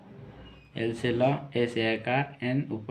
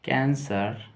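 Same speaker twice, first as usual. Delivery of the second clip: slow dictation; much slower than usual. Shown as a demonstration. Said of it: "चलिए लिखते हैं cancer dropsy fever".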